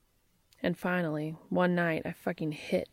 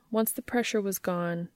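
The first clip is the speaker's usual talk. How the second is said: ordinary delivery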